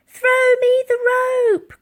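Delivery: high, loud, slow call. Acted. Elsie calling to the soldiers for the rope. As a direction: shout the order across the ice. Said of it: "called out Elsie".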